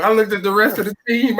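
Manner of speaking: high, amused storytelling; real laughing speech. Real, not acted. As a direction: still tickled by the story. Laughing at own funny story.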